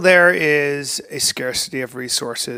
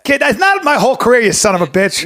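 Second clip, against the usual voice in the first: mock indignant outburst; 6 semitones higher, 4 dB louder than usual.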